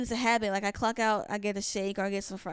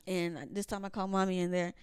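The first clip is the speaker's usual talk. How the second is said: usual speaking voice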